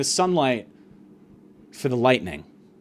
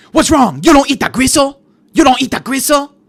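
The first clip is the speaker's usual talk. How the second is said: high, loud mock-outraged questioning; imitated waitress shrieking at a diner; screaming waitress; 8 semitones higher, 9 dB louder than usual. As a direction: yell it in someone's face as a pushy waitress. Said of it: "Screaming in her face".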